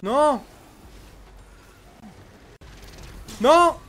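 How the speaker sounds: loud high panicked shouting; explosive italian cursing. Real, not acted. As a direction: yell in sudden panic. Panicked under attack in game. (imprecazioni esplosive)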